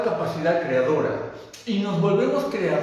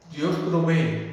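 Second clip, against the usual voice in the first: ordinary talk, nothing marked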